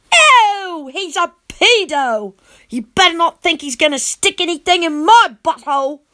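high, loud, grossed-out squeal; disgusted kid. A narrator's reading. Boy repulsed by the leering vampire, high squeal. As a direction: recoil in disgust.

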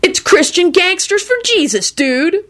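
very high, zealous dude voice; pious. A narrator's reading. Diner defends his faith to doubter, pious. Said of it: "Kenny informed him piously".

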